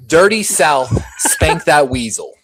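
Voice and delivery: loud, slow drill-hall yell; yelled drill saying. Retold or imitated. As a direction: bellow it like a recruit in formation. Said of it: "he would make us yell out".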